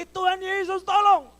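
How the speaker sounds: high, very loud cry for help; desperate shout. Acted. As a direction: yell up to heaven for rescue. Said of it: "Dia teriak lagi".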